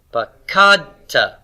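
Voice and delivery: "pakadta" slowly, long KAD and light ta; stress on root two. Shown as a demonstration. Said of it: "It's on root two".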